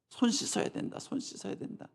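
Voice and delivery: whispered mockery of nagging; mock the Pharisees' nagging in a whisper. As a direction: sneer it under your breath.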